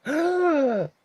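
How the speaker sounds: high sharp shocked gasp; nymphs gasping. Retold or imitated. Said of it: "All the nymphs go".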